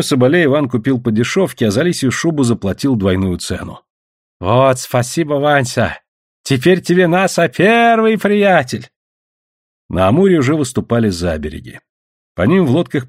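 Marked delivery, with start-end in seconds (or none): 4.4-8.9 lisped, broken Russian thanks; pleased trader voice with drawn-out finish; grateful native trader. Acted. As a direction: thank him in a put-on accent, swelling high at the end.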